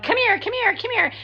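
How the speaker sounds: imitating her high-pitched dog call; squeaky, repeated mock summons. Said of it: "She kept calling the dog".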